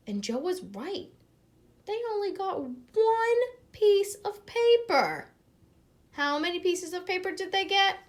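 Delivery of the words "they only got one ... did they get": much higher, lively question to listeners; high storytime voice. A narrator's reading. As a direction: ask the children playfully. Reader voices class question.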